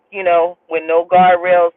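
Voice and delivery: normal talking voice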